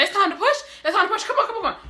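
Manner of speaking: high, loud surprised echo; eager excitement. Retold or imitated. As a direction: act out thrilled urgency, very high. Her excited reply to staff.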